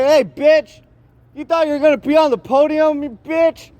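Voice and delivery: high-pitched mock yell at a rival; over-the-top taunting voice, pitched high; acted yelling. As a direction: ham it up like a trash-talking heckler. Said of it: "let me do one more piece of acting".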